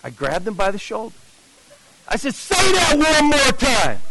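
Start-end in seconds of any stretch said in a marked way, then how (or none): 2.3-4.0 high, drawn-out insistent cry; urgent demand. Retold or imitated. Man grabbing the evangelist's shoulder, high urgent demand. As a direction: stretch each word high, desperate to hear it.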